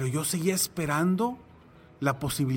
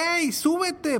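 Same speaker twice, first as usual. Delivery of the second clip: higher, urgent call to the water; calling out from a boat; 12 semitones higher, slower than usual. Retold or imitated. Fisherman calls to the drowning man, higher urgent call. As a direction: shout the rescue offer up high.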